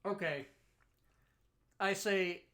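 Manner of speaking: ordinary talk, nothing marked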